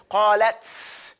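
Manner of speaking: "qalat" drawn out with a long hissed "ts"; over-affected ending. Shown as a demonstration. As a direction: overdo the final t of "qalat" into a long hiss. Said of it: "over affect".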